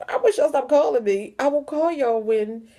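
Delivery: fed-up plea to stop calling; retelling how annoyed she was at the calls; exasperated. Retold or imitated. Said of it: "at first I was like".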